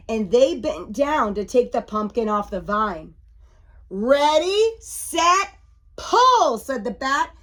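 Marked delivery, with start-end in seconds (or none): 3.9-6.6 loud command climbing in pitch; rally the team for a heave; raised, punchy starting call; calling a countdown. A narrator's reading. Bat gives the command.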